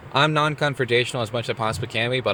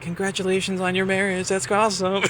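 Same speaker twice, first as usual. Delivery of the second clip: light high passengers; 9 semitones higher than usual. Retold or imitated. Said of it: "they were like".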